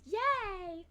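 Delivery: mock cheer. Real, not acted. Mock cheer about restarting Blender.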